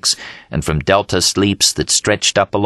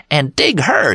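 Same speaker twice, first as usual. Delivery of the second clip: higher, delighted call; excited yell; 8 semitones higher, slower than usual. Acted. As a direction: nudge everyone to look. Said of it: "yelled Dean".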